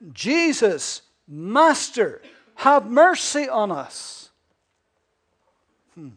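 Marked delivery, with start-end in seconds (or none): 0.1-4.3 loud, pleading cry; lifted voices. Retold or imitated. Lepers crying out to Jesus, loud and pleading. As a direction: call out for mercy, loud and slow. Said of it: "they lifted up their voices".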